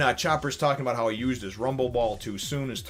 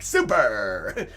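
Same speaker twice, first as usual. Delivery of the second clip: louder drawn-out catchphrase; catchphrase shout; 5 dB louder than usual. Retold or imitated. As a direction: belt out the hero's catchphrase. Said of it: "I still picture him being like".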